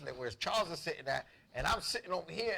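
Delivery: ordinary delivery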